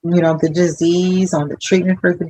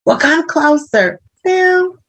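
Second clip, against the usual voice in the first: high comic protest; drawn-out, playful high-pitched refusal; slow, high mock alarm; acted exchange; 10 semitones higher, slower than usual. Acts out exchange with patient.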